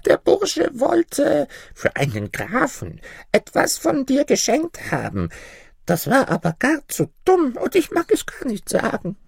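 put-on very high old woman voice; high-pitched, wheedling little granny; coy old granny. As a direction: hesitate teasingly in a tiny crone voice. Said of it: "erwiderte das Mütterchen".

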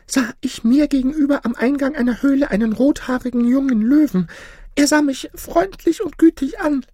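very high-pitched goose voice; troubled goose storyteller. Acted. Goose telling the peacocks about her dream, troubled.

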